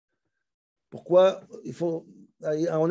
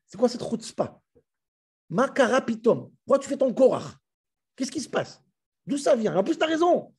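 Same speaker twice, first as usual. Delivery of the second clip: higher, incredulous challenge to Aaron; acted outrage at a brother who contradicts; indignant rebuke; 7 semitones higher than usual. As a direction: demand an explanation, pitched up in disbelief.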